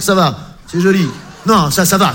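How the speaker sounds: ordinary delivery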